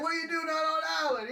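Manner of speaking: high fast yelled question; shouted. Retold or imitated. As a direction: call out across the water. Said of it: "And he's like".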